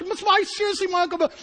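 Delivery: very high, excited re-enacted exclamation; acted-out amazement. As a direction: relive spotting a relative on the highway. His amazement on spotting uncle, retold.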